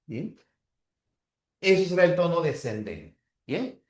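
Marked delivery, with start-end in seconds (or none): none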